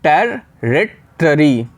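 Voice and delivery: Territory very slow, टेर-रि-टरी; syllable by syllable, territory; split into parts. Shown as a demonstration. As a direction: break territory into three slow chunks. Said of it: "तीन parts में आप लोग को इसे divide करना होगा".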